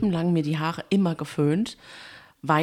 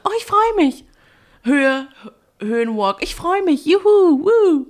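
high, loud gushing delight; excited squeal; 10 semitones higher, 8 dB louder than usual. Retold or imitated. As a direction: squeal it like an overjoyed girl. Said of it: "Die kommt dann dahin und sagt".

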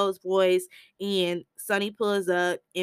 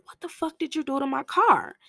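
higher, outraged; angry demand; 8 semitones higher than usual. Retold or imitated. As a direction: bark about the car. Sonny angry about car.

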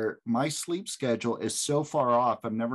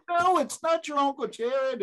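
high-pitched flustered dad; frantic mock denial; 13 semitones higher, 4 dB louder than usual. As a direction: deny it urgently to the kids. Retold frantic denial to kids.

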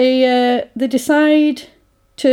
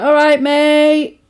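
drawn-out greeting; 6 semitones higher, 5 dB louder, much slower than usual. Retold or imitated. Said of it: "He's like".

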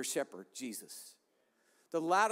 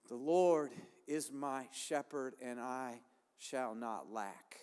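reverent recitation; 7 semitones lower, 5 dB quieter, slower than usual. Acted. Reciting the psalm, dropping to hush.

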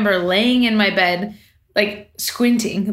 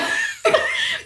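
high squealing laughter; 4 dB quieter than usual. Real, not acted. Laughing while retelling.

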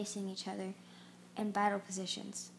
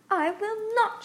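much higher, louder defiant cry; a much higher, louder cry of defiance; cried out; 12 semitones higher, 7 dB louder, slower than usual. A narrator's reading. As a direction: refuse him outright, crying out. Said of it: "she cried".

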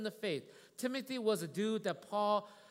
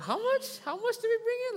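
much higher, stunned "How much?"; astonished; 7 dB louder than usual. Retold or imitated. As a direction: act out your disbelief at the total. Retelling his shock at the total.

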